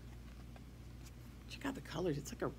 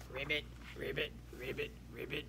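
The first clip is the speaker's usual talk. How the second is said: frog croak; 5 dB quieter than usual. Acted. Teacher imitates frog.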